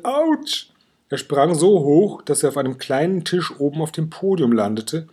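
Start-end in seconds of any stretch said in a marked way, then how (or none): 0.0-0.7 very high pained yelp. A narrator's reading. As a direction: yelp it, suddenly hurt. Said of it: "und er jammerte".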